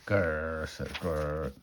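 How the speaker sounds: a deep clown-monster growl. Acted. Scary clown face growls.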